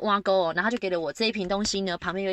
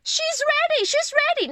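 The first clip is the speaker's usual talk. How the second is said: high, loud call of a midwife; nurse shouting; 5 dB louder, slower than usual. Retold or imitated. Midwife calling to staff, loud excited shout. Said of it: "那个助产师还大叫说".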